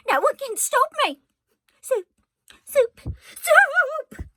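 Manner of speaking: loud, high repeated shouts; defiant shouting. A narrator's reading. Cook refusing to hush for Louise, shouting loud and high. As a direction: yell it again and again in defiance.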